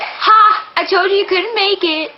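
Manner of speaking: high, loud mocking crow of triumph; playful imitation of a smug told-you-so; gloating husband. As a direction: rub it in like a teasing husband. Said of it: "then it's gonna be like".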